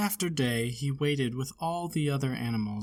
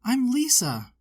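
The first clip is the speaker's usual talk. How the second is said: put-on much higher, louder bright child; happy little girl; 9 semitones higher, 5 dB louder than usual. A narrator's reading. Lisa meeting her bear again, bright and high. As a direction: beam while saying your name.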